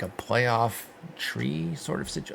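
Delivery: usual speaking voice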